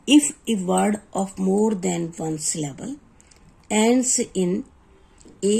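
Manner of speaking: slow, deliberate reading of a spelling rule; teacher dictating a rule to students, very slow; rule dictation. Shown as a demonstration. Reads English spelling rule slowly.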